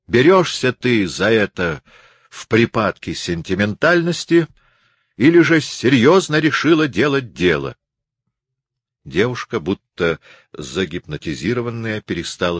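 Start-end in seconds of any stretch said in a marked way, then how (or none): 0.1-7.8 pressing demand. A narrator's reading. Martin presses her for an answer.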